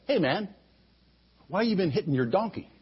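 a cool angel asking lightly; lightly voiced angel; a hint of angel. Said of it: "the angel says".

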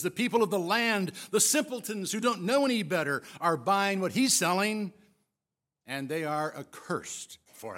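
loud, contemptuous scoffing; scornful Pharisees. Acted. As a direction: sneer at the ignorant crowd.

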